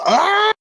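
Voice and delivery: high-pitched mock scream; sudden high comic shriek; imitated scream. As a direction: let out an imitated high wail. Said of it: "he just goes".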